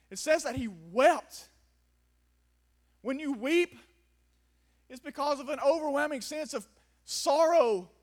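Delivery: preaching at full force. Acted. Preacher to congregation about Jesus weeping, loud impassioned preaching. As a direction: drive home his pain to the church.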